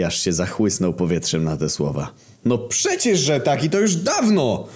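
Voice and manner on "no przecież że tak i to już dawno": slow, very high outraged reply; offended outburst; indignant. A narrator's reading. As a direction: protest affronted, stretching the words. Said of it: "odpowiedział oburzony".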